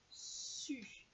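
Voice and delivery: su hissed like a snake; teacher hissing su like a snake; hissed syllable. Shown as a demonstration. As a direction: breathe out su with a long s.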